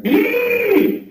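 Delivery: very high yelped gee; sudden shrill squeal. Retold or imitated. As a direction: yelp it sky-high. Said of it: "I hear".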